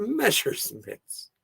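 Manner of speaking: ordinary talk, nothing marked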